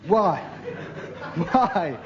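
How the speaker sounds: ordinary delivery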